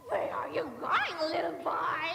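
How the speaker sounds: ordinary delivery, nothing marked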